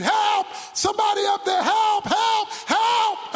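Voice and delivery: high, loud cry for help; screamed plea. Acted. Falling man to anyone above, screaming for help. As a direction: yell up desperately from the ravine. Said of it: "and he screamed".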